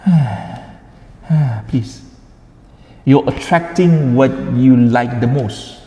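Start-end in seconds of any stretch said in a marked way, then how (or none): 0.1-1.6 sigh. Shown as a demonstration. Lecturer warns students against sighing, demonstrative sigh. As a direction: let out a sigh to show the habit. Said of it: "if you are in the habit of".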